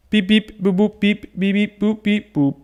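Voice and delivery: high playful beep boop chant; idle robot noises. Pretend. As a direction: chirp it like a little robot. Humming robot noises to self.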